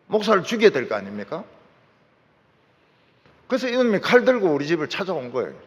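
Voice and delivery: ordinary delivery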